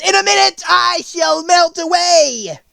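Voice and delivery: high, loud witch scream; horrified witch shriek. A narrator's reading. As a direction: shriek it in panic as the melting witch. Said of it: "she screamed".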